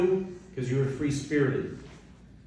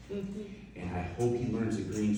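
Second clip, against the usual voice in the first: ordinary delivery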